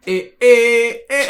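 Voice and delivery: high, loud "eh" sound effect; imitated portal noise. Fan acting out the portal for a co-host, high and loud. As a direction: squeak out a portal cutting off, loudly. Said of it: "and it goes".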